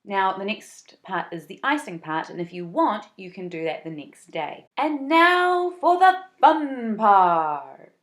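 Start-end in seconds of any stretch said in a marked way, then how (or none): 4.8-7.9 booming slow ringmaster call; circus voice. Acted. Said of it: "that was my circus voice".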